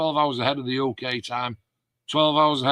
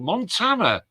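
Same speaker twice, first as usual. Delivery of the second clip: surprised exclamation; 7 semitones higher than usual. Real, not acted. Amazed at viewer location.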